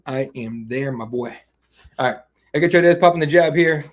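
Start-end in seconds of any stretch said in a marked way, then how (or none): 2.5-3.8 loud hyped fight commentary; excited call. Real, not acted. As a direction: call the jab like it's huge. Calling fight live on stream.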